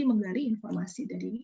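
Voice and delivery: usual speaking voice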